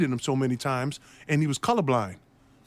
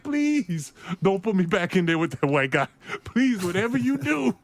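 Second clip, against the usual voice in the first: mock pleading; 6 semitones higher than usual. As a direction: plead like you dread going back in. Scared rookie begs coach silently.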